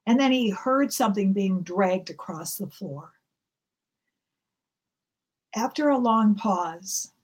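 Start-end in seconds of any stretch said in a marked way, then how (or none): none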